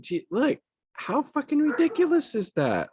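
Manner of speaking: high-pitched, exasperated disbelief; incredulous outburst. Real, not acted. Commentator appalled by a smirking charge, incredulous outburst.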